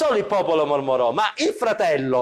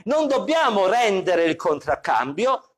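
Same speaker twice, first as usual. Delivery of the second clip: usual speaking voice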